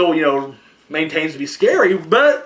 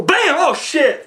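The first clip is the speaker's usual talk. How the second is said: startled jump; 8 semitones higher, much slower than usual. Retold or imitated. Moviegoer jolted by a jump scare, startled yelp. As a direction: jump out of your seat on it.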